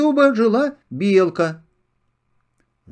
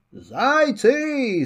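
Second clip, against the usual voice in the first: calling out; 6 semitones higher than usual. Acted. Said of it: "закричала она".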